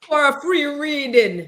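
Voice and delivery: slow, high Jamaican hotline pitch; Jamaican psychic ad. Retold or imitated. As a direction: sell a free reading like a TV psychic. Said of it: "my Jamaican accent".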